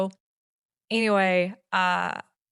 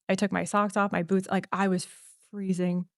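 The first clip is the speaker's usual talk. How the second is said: ordinary talk, nothing marked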